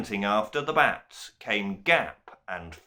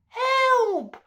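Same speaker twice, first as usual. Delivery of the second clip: very high wail for help; 4 dB louder than usual. A narrator's reading. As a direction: cry out in terror. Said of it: "wailed Milktooth".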